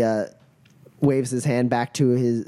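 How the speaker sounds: normal talking voice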